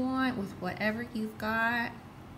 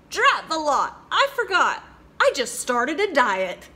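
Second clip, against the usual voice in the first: louder, higher hurried excuse; flustered excuse; 4 semitones higher, 6 dB louder than usual. Acted. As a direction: blurt out a fake excuse. Frog making an excuse to mouse.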